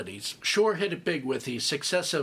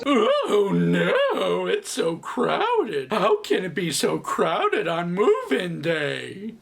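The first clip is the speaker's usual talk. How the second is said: higher, drawn-out sarcastic wail; hammed-up despair, dragging out the words; mock dismay; 4 semitones higher, 4 dB louder, much slower than usual. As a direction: whine it slowly in exaggerated fake distress. Mocks the crowded move-in scene.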